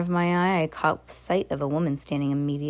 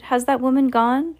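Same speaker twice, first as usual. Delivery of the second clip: high, loud anxious call; frightened boy calling down, high and loud; shouted question; 8 semitones higher, 4 dB louder than usual. A narrator's reading. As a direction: yell the question down from the tree. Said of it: "I shouted".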